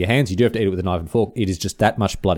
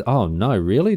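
usual speaking voice

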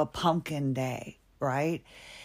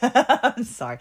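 laughing apology; 5 semitones higher, 6 dB louder than usual. Real, not acted. Laughing at own joke.

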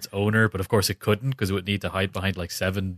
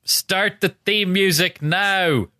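higher, very slow broad Donegal accent; exaggerated Donegal accent; 10 semitones higher, much slower than usual. Shown as a demonstration. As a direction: stretch every word in thick Donegal. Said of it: "getting elocution lessons, you big Donegal".

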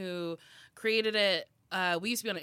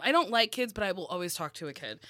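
normal talking voice

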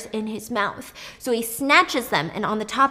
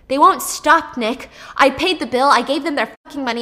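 louder, frantic complaint; agitated; 3 semitones higher, 6 dB louder than usual. Retold or imitated. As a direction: spit it out fast, desperate. Brother panicking, arguing.

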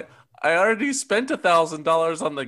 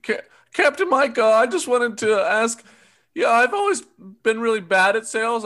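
nervous nerd voice; 4 semitones higher, 6 dB louder than usual. Acted. Nervous nerdy recruit.

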